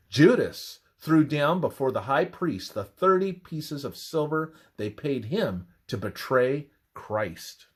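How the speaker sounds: normal talking voice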